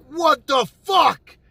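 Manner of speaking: high, slow, loud outraged shout; freaking out, pissed. Retold or imitated. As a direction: stretch each word into a furious retold yell. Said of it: "I'm freaking out, man. I'm pissed".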